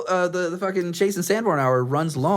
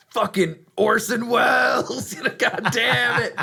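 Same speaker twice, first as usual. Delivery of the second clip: mock outrage; 8 semitones higher, slower than usual. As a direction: shake your fist at him.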